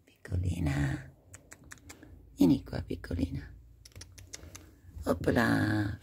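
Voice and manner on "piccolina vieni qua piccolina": hushed whisper to a kitten; soft coaxing whisper. Real, not acted. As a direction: whisper it so you don't scare her. Coaxing a shy cat closer.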